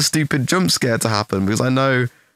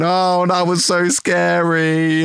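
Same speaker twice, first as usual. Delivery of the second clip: slow, higher, deadpan-ironic scared; sarcastic mock fright; 6 semitones higher, slower than usual. Eyes shut through the jump scare, sarcastic mock fright. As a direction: pretend you were frightened, clearly joking. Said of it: "my eyes closed going".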